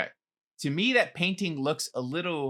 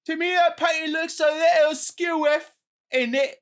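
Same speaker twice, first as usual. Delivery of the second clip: high, hammed-up cockney British accent; exaggerated British dialect, pitched up; 9 semitones higher than usual. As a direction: play a cheeky British guv'nor type. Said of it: "all the different dialects".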